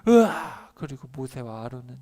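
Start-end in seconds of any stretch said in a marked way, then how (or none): 0.1-0.7 loud high scream of fright; mock scream. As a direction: let out a frightened yell. Scream at stone throwing.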